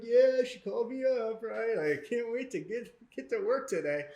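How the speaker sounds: put-on high-pitched, keen young employee; chipper, pitched well up; eager reply. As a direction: gush about heading into work. Acting out own eager younger self.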